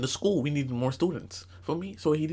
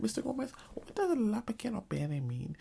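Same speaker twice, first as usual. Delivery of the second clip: higher, softer questioning pupil voice; mock-innocent question; 7 semitones higher, 6 dB quieter than usual. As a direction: ask the teacher sweetly, pitched up. Mock-innocent pupil asking teacher.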